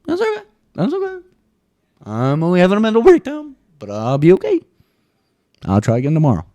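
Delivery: high, bright sing-song reassurance; squeaky fake-brave pep talk to myself; chirpy denial over a breakdown; mock cheery self-talk. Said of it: "I'm like".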